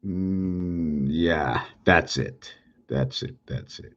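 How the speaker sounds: low, soft self-talk, fading away; muttering to self. Real, not acted. Presenter checking their own drawing, muttering to self. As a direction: murmur it low, trailing to almost nothing.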